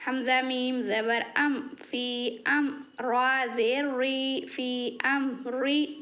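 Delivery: normal talking voice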